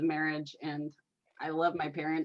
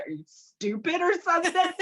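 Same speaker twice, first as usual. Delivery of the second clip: high mock elf voice, scornful; snooty elf; 11 semitones higher, 3 dB louder than usual. Medium imitating the elf mocking her, high scornful voice. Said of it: "he's like".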